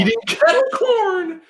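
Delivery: high excited squeal. Real, not acted. Excited echo of kettle corn.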